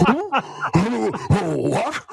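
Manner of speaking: high, startled talking-dog voice; dog's accent. Pretend. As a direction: play a dog suddenly on alert. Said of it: "I like your dog's accent".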